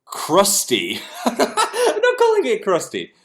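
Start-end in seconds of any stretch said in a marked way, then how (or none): 1.2-3.1 laughing through the name. Real, not acted. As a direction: cracks up at the silly name.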